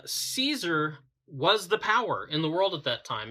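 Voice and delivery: usual speaking voice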